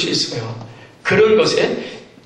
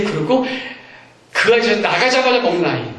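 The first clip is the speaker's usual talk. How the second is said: normal talking voice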